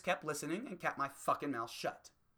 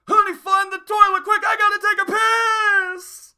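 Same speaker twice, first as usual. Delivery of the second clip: much higher and much louder, a slow blaring yell; sudden shouted voice crack; 19 dB louder, slower than usual. Retold or imitated. Girlfriend suddenly urgent on the way to the store, loud blaring shout. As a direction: blurt it out at full volume, voice cracking out of control. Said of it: "all of a sudden I hear this".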